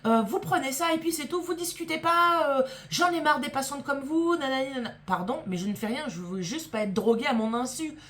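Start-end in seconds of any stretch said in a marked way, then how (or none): 0.1-4.4 high, loud, exasperated scolding of a patient; mock the nurse snapping and yelling, pitched way up; retold angry yelling. Anesthetist yelling at a resisting patient, high-pitched angry scolding. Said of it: "Elle me hurle dessus". 4.4-4.9 high teasing nanana, mimicking the rant; childish mock gibberish, pitched up; mocking singsong. Patient mocking the anesthetist's rant, high teasing singsong.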